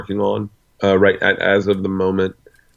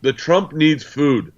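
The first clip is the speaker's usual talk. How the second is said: much higher, slowed, hammed-up caricature; mock Trump voice; 9 semitones higher than usual. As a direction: ham up a cartoonish strongman, drawing it out. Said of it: "He said".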